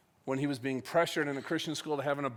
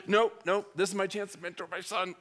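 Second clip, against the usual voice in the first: high-pitched frantic self-coaching; acted-out determined self-restraint; urgent self-talk; 5 semitones higher than usual. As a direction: talk yourself down from the edge. Acts out stopping his own anger.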